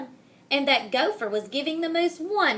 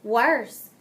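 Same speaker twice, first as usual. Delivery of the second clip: ordinary delivery, nothing marked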